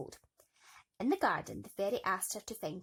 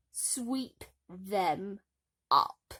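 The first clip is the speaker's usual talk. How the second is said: mocking order; 7 dB louder, much slower than usual. A narrator's reading. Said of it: "she laughed".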